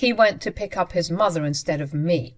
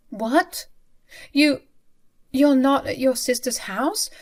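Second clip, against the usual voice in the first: higher, stammering disbelief; stunned disbelief; 7 semitones higher than usual. Acted. Wife learning the truth from mother-in-law, stunned. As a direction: caught off guard.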